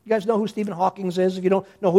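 ordinary delivery

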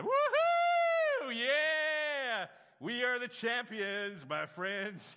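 huge high whooping shout, then a slow sung champions chant; jubilant whoop. Retold or imitated. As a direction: cheer like a winning fan, singing the chant slowly. Bursting out after the miracle.

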